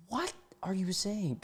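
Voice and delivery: high, soft, baffled question. Real, not acted. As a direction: squeak it out in disbelief. Baffled by his garbled text.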